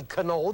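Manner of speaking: KANO as ka-NO, K pronounced; sounded K. Shown as a demonstration. Teacher supposing a misreading, KANO with sounded K. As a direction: put a voiced K syllable before NO in KANO. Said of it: "If you did say".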